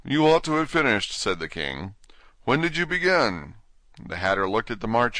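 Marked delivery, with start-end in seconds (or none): 0.0-1.1 king voice. Acted. King scolds the Hatter. 2.4-3.5 king voice. Acted. King questions the Hatter.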